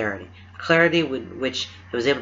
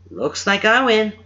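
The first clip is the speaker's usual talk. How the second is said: smug teasing; 10 semitones higher, 6 dB louder than usual. Acted. Lance teasing after winning.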